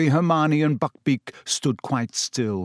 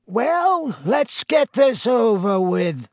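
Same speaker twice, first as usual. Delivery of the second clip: high, drawn-out reedy voice; reedy official; 10 semitones higher, 3 dB louder, slower than usual. A narrator's reading. Committee member hurries the execution along, reedy voice. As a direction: pipe it up thin and slow. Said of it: "said the reedy voice of the committee member".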